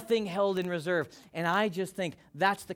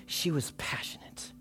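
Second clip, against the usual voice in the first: hushed; 3 semitones lower, 9 dB quieter than usual. Real, not acted. Preacher whispering the point to the church. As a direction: whisper it, breathy and soft. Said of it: "whispered point".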